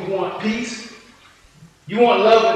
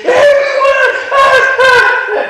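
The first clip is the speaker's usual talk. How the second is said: mock victory yell; 12 dB louder, much faster than usual. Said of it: "hollered and screamed".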